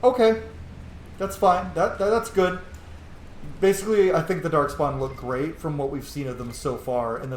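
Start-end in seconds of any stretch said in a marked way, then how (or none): 0.0-2.6 pleased okay. Retold or imitated. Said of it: "and I was like".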